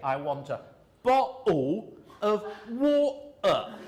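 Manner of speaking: East London accent, glottal stop in "bottle" and "water", drawn out; Cockney glottal stops. Shown as a demonstration. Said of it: "they say, glottal stops".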